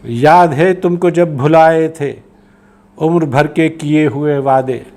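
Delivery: slow, lifted delivery, stretched phrases; poetry recitation. Acted. Poet asking home about broken lifelong promises, slow poetry recitation. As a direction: pose each verse as a lingering question.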